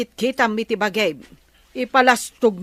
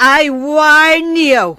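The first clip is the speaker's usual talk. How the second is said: slow, loud warning with a higher pitch; stern warning; 4 semitones higher, 9 dB louder, much slower than usual. Acted. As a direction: lay down a firm warning word by word. Wife threatens boastful husband.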